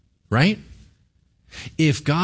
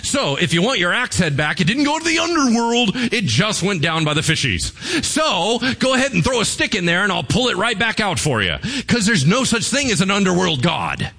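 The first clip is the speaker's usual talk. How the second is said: casual, amused God, higher, rising to a shout at the stick line; breezy, teasing God brushing off the idea; laid-back deity shrugging it off; 7 semitones higher than usual. Retold or imitated. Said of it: "He's like".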